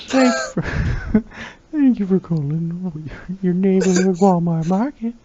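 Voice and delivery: put-on high, slow creepy old-man phone greeting; Herbert the Pervert. Walmart worker answering the phone as Herbert, high and creepy. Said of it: "answer the phone at my Walmart as Herbert the Pervert".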